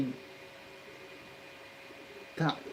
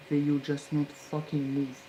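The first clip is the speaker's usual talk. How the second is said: ordinary talk, nothing marked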